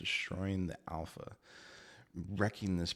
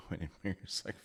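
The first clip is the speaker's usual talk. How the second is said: usual speaking voice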